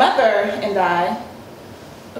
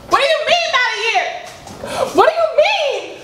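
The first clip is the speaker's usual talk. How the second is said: shrill disbelief; 14 semitones higher than usual. Acted. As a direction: throw the question back at him, outraged. Wife confronts husband about affair.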